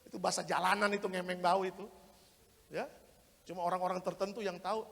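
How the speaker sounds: normal talking voice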